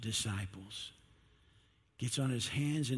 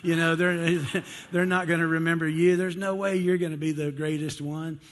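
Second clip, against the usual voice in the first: higher and louder, laughing through the brush-off; shouting back; 4 semitones higher, 6 dB louder than usual. Retold or imitated. Disciples shout Bartholomew down in a mocking yell. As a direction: wave him off with a loud scoff. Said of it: "I can hear 'em shouting back to Bartholomew".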